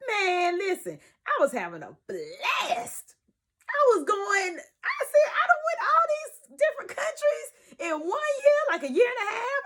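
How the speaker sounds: high, loud and cracking with excitement; excited shout. Retold or imitated. Vlogger to viewers, remembering the good years, excited shouting. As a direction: shout it out like life was a blast. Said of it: "That was my YouTube voice".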